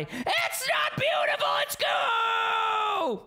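very high, loud protest, slowed; outraged shout. Pretend. Player contradicting the narrator, high outraged shout. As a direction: shout back at the story in mock outrage.

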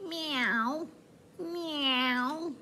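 high little cat meow. Acted. Showing the children how the cat says meow. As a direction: mew like a kitten. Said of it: "Can you tell me?".

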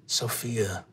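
put-on low, slow, soft older guy; sizing-up older man. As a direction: mull the name over in a low voice. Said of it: "he looks at you and says".

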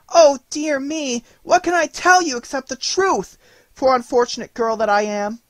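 put-on a child sobbing out her innocence; crying bitterly. A narrator's reading. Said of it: "she began to cry bitterly".

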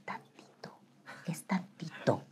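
tempting whisper; sly hushed temptation; whispering the flesh. Retold or imitated. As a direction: coax softly, just a little bit. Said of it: "es tu carne, que te dice".

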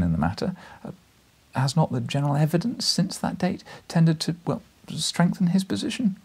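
put-on nervous young journalist. A narrator's reading.

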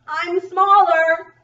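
high, loud, drawn-out bug voice; slow storybook one-upping; smaller bug. Acted. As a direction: top the last bug, stretching the words. Builds up bug comparison.

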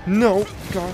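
high, loud frightened cry; scared and angry. Real, not acted. Attacked by spider.

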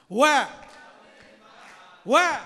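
a sudden very loud call; shouted question. Acted. Preacher to congregation, a huge shouted question. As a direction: fling the word out to the crowd.

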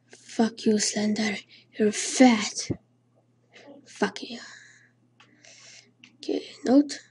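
hushed whisper; breathy hiding whisper. Real, not acted. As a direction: whisper so the monster won't hear. Whispering while hiding from Slender.

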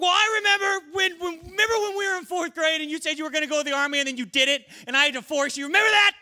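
loud high rant; high, loud, fast accusation; angry soldier. Acted. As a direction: rage at an old grudge. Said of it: "He's like".

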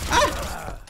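high yelp; startled yelp. Real, not acted. Gamer startled by enemy, sharp high yelp. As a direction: jump at it.

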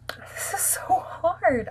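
whisper. Real, not acted. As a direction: breathe it out, overwhelmed. Whispered fret.

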